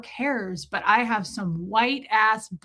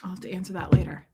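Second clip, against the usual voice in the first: quick, voiceless whisper; whispered aside; 3 semitones lower, faster than usual. Real, not acted. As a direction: whisper it fast to yourself. Aside while reading comments.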